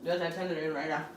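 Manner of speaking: talking through a mouthful; words round a bite; chewing steak. Real, not acted. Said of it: "the steak is tender".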